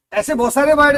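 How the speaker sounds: ordinary delivery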